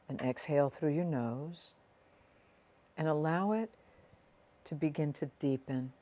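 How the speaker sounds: soft, unhurried meditation voice with long pauses; guided meditation. Real, not acted. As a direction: ease the listener gently inward, leaving space between phrases.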